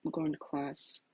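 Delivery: dejected under-the-breath mutter. Acted. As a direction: mumble it as you leave. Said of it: "he muttered".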